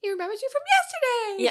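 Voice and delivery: very high, loud, quick squeal; re-enacted excited scream. Replaying the screaming reaction for the host, excited squeal. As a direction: squeal it out, breaking into laughter.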